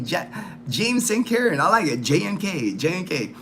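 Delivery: usual speaking voice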